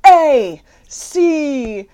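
mock trance spelling. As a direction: spell as if entranced. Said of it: "into this trance".

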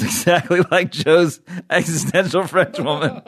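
laughing through the words; amused laugh in the voice. Real, not acted. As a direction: giggle out the end of the line. Laughing at the memory.

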